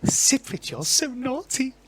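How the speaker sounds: high, airy, naughty tease; playful high-pitched feminine voice; coy squeal of mock scolding; flirty Julia. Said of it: "sound of Julia Strophes going".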